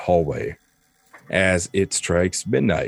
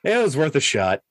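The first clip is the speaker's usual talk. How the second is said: higher-pitched Baron voice; sheepish baron; 7 semitones higher than usual. Acted. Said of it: "and he's like".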